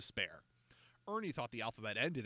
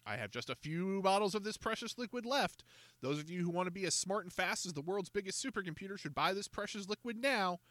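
higher, eager sales pitch; salesman's pitch; 8 semitones higher than usual. A narrator's reading. George selling bottles to the children, high sales pitch. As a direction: sell the miracle to the kids.

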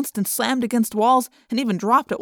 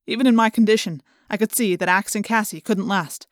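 usual speaking voice

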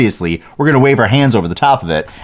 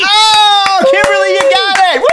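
thrilled, high and loud cheer; excited shout; 3 dB louder, slower than usual. Real, not acted. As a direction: shout it out in pure delight. Host celebrates listener's score.

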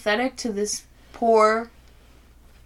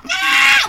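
imitated rabbit scream; 9 dB louder than usual. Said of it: "It's like".

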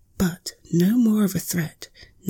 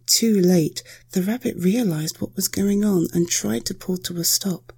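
ordinary delivery

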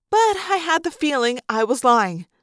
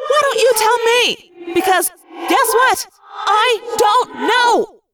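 panicked, higher and louder; panicky; 10 semitones higher, 4 dB louder than usual. A narrator's reading. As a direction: panic rising in every word. Rachel panicking while morphing.

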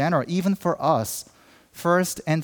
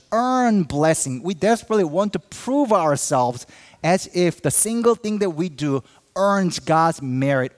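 ordinary delivery

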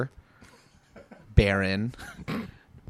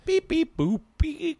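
high beeping toy robot; 14 semitones higher, slower than usual. Shown as a demonstration. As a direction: beep like a little toy robot. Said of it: "that one was good".

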